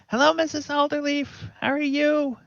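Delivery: put-on a frantic little kid calling out hello; excited kid voice. Young Pip waving and calling hello to the farm woman, high and eager. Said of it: "Pip waves frantically and says".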